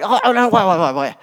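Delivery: high start, tumbling down in hasty protest; flustered backpedalling. Acted. Imagined Jesus to the religious leaders, hastily backpedalling. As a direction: stammer out a hurried retraction.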